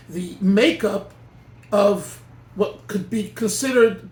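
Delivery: normal talking voice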